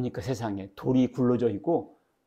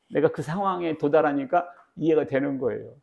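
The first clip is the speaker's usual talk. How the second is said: normal talking voice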